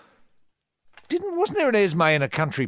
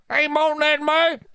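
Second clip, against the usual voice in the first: much higher, chirpy put-on hello; high mock greeting; 12 semitones higher than usual. Host greeting Esme in a high put-on voice. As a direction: sing out a silly hello like a neighbour.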